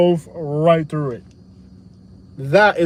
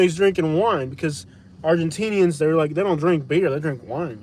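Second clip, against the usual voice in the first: ordinary talk, nothing marked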